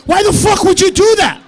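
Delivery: high, loud outburst of disbelief; exasperated shout. Acted. Comic questioning the surfer's choice, exasperated shouting. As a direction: yell it as if utterly baffled.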